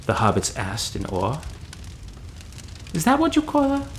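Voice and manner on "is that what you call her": put-on much higher, puzzled girl voice. A narrator's reading. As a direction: ask it high and surprised.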